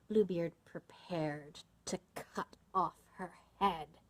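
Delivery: slow, deliberate, word by word; measured storyteller's suspense; suspenseful narration. A narrator's reading. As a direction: draw out the dread. Suspense before the execution.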